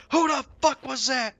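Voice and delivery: loud, high angry yell; mock furious outburst. Raid leader demanding who failed, loud furious yell. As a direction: explode at the player who failed. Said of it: "then he just goes".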